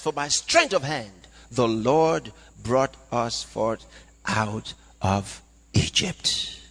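low, spaced-out scripture; slow, weighty reading. Acted. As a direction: let each word of the verse land. Verse slowed for weight.

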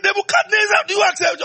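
high ringing shout of the question; calling shout. Acted. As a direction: shout the demand across the years. Preacher voicing God's yearly question.

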